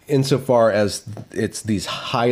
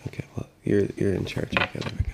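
hushed aside; 8 dB quieter, faster than usual. Real, not acted. Quiet aside while she works.

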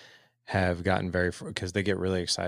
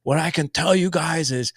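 high, loud imitation of a Scottish speaker; emphatic mock-Scottish warning, drawn out at the end; Scottish accent; 8 semitones higher, 9 dB louder, slower than usual. Scottish guest warns the men at the summit, imitated Scottish accent. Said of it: "this really cool Scottish accent".

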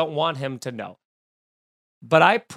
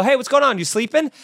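louder, higher breezy phone greeting; fake-innocent wake-up check; 7 semitones higher, 4 dB louder, faster than usual. Shown as a demonstration. Teaching listeners the prank call, faux-innocent greeting. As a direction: ask it like they must have dozed off.

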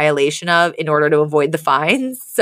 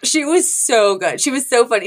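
ordinary delivery

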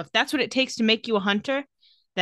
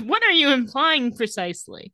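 mock prim offense; 5 semitones higher than usual. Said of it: "She says".

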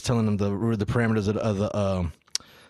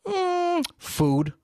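put-on child voice. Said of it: "son's reply".

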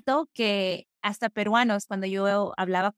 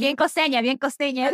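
playful teasing; 6 semitones higher, 5 dB louder than usual. Real, not acted. Teasing remark about coastal origins.

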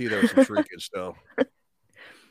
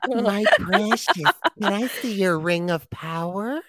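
high, very slow hissing creature; creepy creature voice; 8 semitones higher, 5 dB louder, slower than usual. Pretend. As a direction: stretch out each word like a creepy ring-obsessed creature. Creepy ring creature quote.

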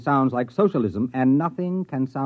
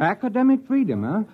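puzzled surprise; 10 semitones higher than usual. Acted. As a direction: pitch jumps up in baffled surprise. President hears odd definition, puzzled.

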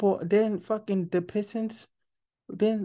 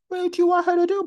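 laughing; 10 semitones higher, 7 dB louder, faster than usual. Real, not acted. Laughing rant about critics.

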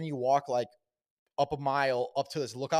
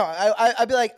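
usual speaking voice

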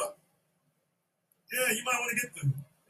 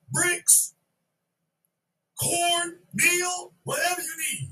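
high pleading list; 6 semitones higher, slower than usual. Retold or imitated. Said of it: "He's like".

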